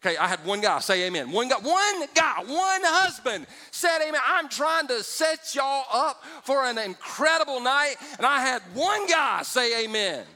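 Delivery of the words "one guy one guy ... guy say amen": loud, slow, high-pitched exasperation; mock outrage at the silent men; exasperated shout. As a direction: hammer it home like a fed-up preacher. Mock-frustrated preacher repeating himself.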